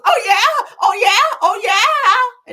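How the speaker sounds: loud, high mock cries of passion; overheard moaning. Wife overhears them through the door, loud high mock moaning. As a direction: belt it high, drawing out the last one. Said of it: "all she could hear was".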